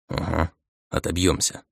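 quiet, skeptical grumble; doubtful mutter. Acted. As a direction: mutter it, not believing a word. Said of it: "недоверчиво буркнул я".

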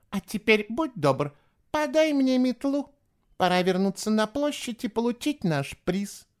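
put-on high, brisk girl's voice; scornful girl. A narrator's reading. Eva demanding her broom back, high and bossy. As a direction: order him about in a high girlish voice.

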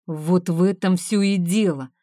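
ordinary delivery

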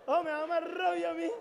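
exclamación fuerte y alta; rabia exagerada. Real, not acted. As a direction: queja cómica de rabia. (se queja con rabia teatral, más fuerte)

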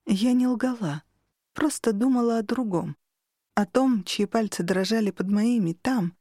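plain reading voice

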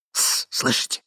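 urgent hushing whisper; voiceless stage whisper. A narrator's reading. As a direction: shush him and whisper. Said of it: "прошептал молодой человек".

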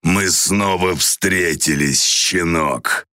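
slow, cold stone-hard voice; menacing savage, stony and very slow. A narrator's reading. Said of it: "каменный голос".

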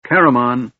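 calling out. A narrator's reading. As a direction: call his name across the camp. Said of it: "A call split the afternoon's sweet silence".

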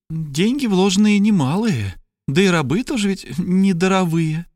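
high-pitched character reply; hopeful Trasul. A narrator's reading. Trasul answers hoping the stranger will bite, high hopeful voice. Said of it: "отвечал Трасул, не теряя надежды, что тот клюнет".

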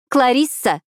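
sharp angry snap of a name; snapping. A narrator's reading. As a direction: bite out the name in reproach. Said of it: "огрызнулась Фиби".

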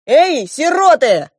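loud, high, drawn-out call to henchmen; barked command. A narrator's reading. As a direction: bellow for the guards. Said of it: "гаркнул старший принц". (гаркнуть, громко и властно)

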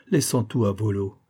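ordinary delivery, nothing marked

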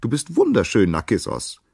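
high, loud chirping bird voice; excited birds in high-pitched chorus; twittering birds. A narrator's reading. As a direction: chirp it admiringly, high and bright. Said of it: "zwitscherten die Vögel zurück".

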